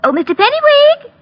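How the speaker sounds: high-pitched call across the store. Real, not acted. Clerk calling for the store manager, high call. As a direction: sing out the name to fetch him. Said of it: "I'm calling the manager".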